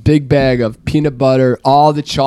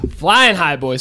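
ordinary delivery

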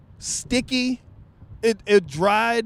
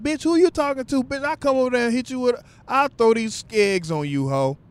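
mock girl fight; 7 semitones higher than usual. Women's fight at IHOP.

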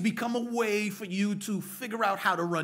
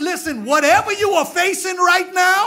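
much louder and higher, shouted preaching; preaching at full force; 10 semitones higher, 13 dB louder than usual. Acted. Preacher shouting to the congregation at full force. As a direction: build the church to its feet, full voice.

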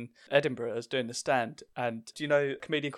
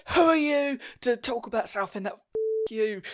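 much higher, louder indignant outburst; livid retort; 10 semitones higher, 5 dB louder than usual. Retold or imitated. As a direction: fire back, furious on behalf of your hometown. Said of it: "I was absolutely livid".

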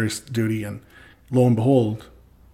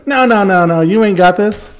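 high, loud mock scolding; hammed-up fatherly objection, raised voice; loud overruling dad; 7 semitones higher, 9 dB louder than usual. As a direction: shout down your son's confidence, imitating dad. Dad overrules his son, retold.

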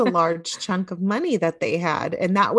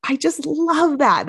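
ordinary talk, nothing marked

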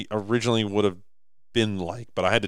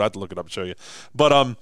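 ordinary talk, nothing marked

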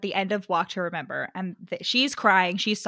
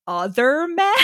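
higher, louder mock-shocked question; incredulous echo; 5 semitones higher, 7 dB louder, slower than usual. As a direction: throw it back in disbelief. Quoting her startled reply to him.

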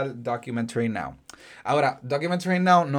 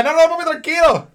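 high, loud mock calming of a dad; hammed-up hush, much higher and louder; teasingly calm a worried father; mock soothing reassurance; 12 semitones higher, 6 dB louder than usual. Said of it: "como que".

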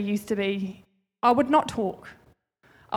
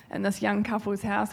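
normal talking voice

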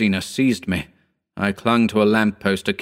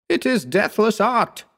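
impassioned raised-pitch exclamation; shouted; 13 semitones higher than usual. A narrator's reading. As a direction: call it out high and insistent. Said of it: "he shouted at me".

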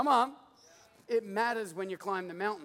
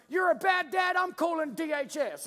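high, loud mock scolding; outraged little kid; 6 semitones higher, 3 dB louder than usual. Dad imitates his son threatening to report him, mock outrage. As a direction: imitate a small boy yelling accusations.

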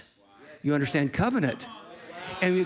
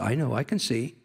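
Jesus, understated; 6 semitones lower than usual. Acted. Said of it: "He says".

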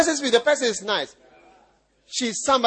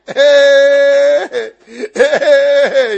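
laughing call; 11 dB louder, much slower than usual. Real, not acted. Preacher laughs while calling out.

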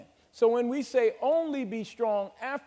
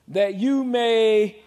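very slow, loud "That you may"; emphatic reading; 7 dB louder, much slower than usual. Shown as a demonstration. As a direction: pause the church on it.

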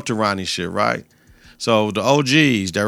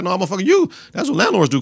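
much higher mock indignant; indignant tenant; 8 semitones higher, faster than usual. Tenant telling Rock her landlord duty, indignant high voice. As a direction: push back hard and high.

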